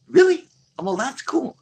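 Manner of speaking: high-pitched mock surprise; acted-out incredulous delight; retold amazement. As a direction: replay an impressed reaction, voice jumping way up. Retold reaction to secret catacombs.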